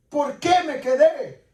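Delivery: normal talking voice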